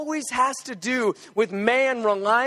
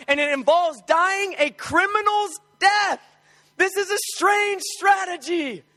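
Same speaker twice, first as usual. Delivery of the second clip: preaching at full force; 4 semitones higher than usual. Acted. Preacher drives home the strange plan, emphatic preaching. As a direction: build the point hard to the congregation.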